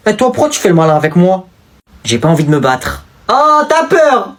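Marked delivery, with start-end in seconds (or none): 3.3-4.3 drawn-out high taunt; slow, mocking "t'as peur". Picking a fight, taunting the other boy slowly.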